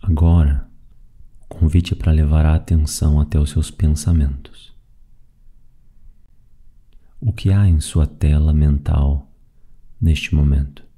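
calm, even meditation voice with long pauses; guided meditation. Acted. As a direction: guide them gently through the breathing, leaving space after each phrase. (meditação guiada, voz calma e pausada)

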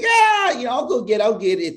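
very high, loud imitation of someone shrugging it off; exaggerated high-pitched "no worries" impression; mock breezy brush-off. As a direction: wave it away in a squeaky put-on voice. Imitates assistant brushing off medicine.

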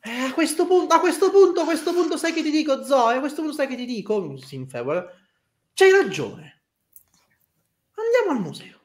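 very high, resigned giving-in; high character voice. Acted. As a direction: throw up hands and give in.

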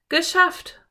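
high, loud cry of relief; triumphant. A narrator's reading. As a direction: exclaim in triumph after a struggle. Philipp gets his boot off.

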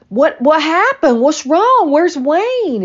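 high, loud, frantic questions; a panicked mother rushing after them; alarmed mother. Retold or imitated. Mother alarmed as father storms in.

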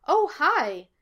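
high, loud, beaming greeting; light it up with a big smile; bright and smiling. A narrator's reading. Sonny greeting the detectives, brightly smiling. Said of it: "smiling brightly".

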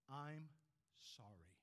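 soft, hushed and humble, "sorry" dropping low; sincere apology. Shown as a demonstration. Preacher showing the congregation a sincere soft apology. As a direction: say "I'm sorry" gently, meaning it fully. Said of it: "say them without any reservation".

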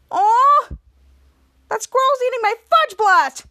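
high, loud mock dismay; outraged cry. Henry sees a squirrel eating his treat, outraged.

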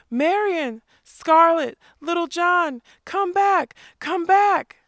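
high, loud, desperate calling; the band begs the legends to come back; shouting. A narrator's reading. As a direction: holler each name as they vanish. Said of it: "they shouted".

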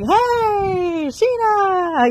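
high, bright welcoming call; friendly restaurant staff calling out; cheery greeting. Retold or imitated. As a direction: greet her like family. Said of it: "they're like".

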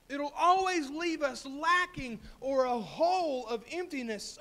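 fervent preaching. Real, not acted. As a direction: drive the point home to the congregation. Preaches the application with rising force.

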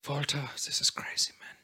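hushed, imitated parents whispering in shock; mock whisper. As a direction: breathe it out in a stunned whisper. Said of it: "were they just like".

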